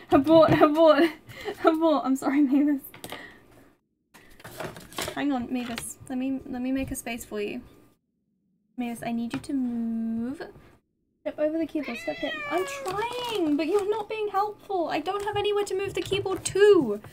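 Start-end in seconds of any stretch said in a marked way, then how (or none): 0.1-2.0 laughing alarm. Real, not acted. Cat heading for keyboard. 12.0-17.0 talking to the cat. Real, not acted.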